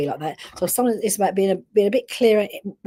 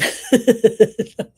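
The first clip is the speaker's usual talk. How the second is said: brief, high burst of laughter; real laugh; 4 semitones higher, 7 dB louder than usual. Real, not acted. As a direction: laugh at her naughty dog. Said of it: "I shouldn't laugh".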